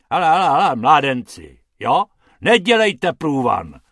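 loud, gruff warning; growling. Acted. Hrubec shoos the panting rabbits away, gruff growl. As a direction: growl at the two lads. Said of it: "zavrčel pan Hrubec".

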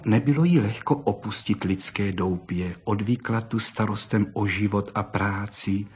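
usual speaking voice

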